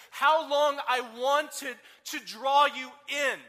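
slow, high and very loud, yearning; a heartfelt cry of longing, very slow; tender longing. Acted. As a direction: draw it out like an aching plea. Jesus longing over Jerusalem.

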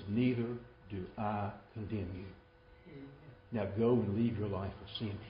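low, hushed words of pardon; Jesus pardoning. Acted. Jesus to the accused woman, softly pardoning. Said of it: "And he says".

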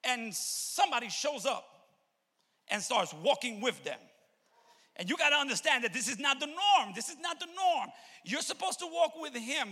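loud, high, driving sermon delivery; full-force preaching. Real, not acted. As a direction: preach it loud to the whole church.

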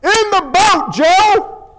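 high-pitched shouted command; acted yell from the boss. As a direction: holler the order at a slow deckhand. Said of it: "Joe's boss yells".

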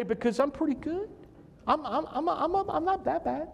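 high, stammering excuse; mock self-justifying. Imagined thief at heaven's door, mock self-justifying.

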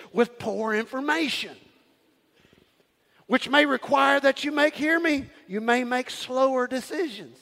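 rising, emphatic sermon delivery; call-and-response preaching cadence. Acted. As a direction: preach it with fire to the congregation. Preacher at full force.

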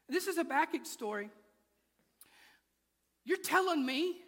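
usual speaking voice

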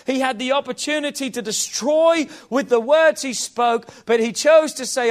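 ordinary talk, nothing marked

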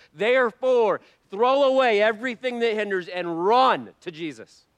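loud, high, full-force sermon delivery; fiery preaching. Acted. Preacher urging the congregation, loud and high. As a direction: preach it at the top of your voice.

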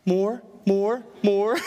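lightly voiced toddler begging; toddler imitation. Imitates toddler asking for more.

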